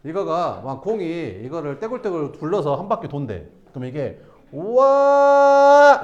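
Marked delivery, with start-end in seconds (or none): 4.5-6.0 high, loud, long drawn-out wow; amazed wow. Pretend. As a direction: cheer like the rolling ball is a show. Teacher acting ball rolling.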